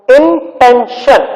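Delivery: "intention" slowly, in three separate syllables. Shown as a demonstration. Teacher recaps the syllables for children, "intention" slowly broken apart. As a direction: break "intention" up with short pauses. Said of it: "So syllabification".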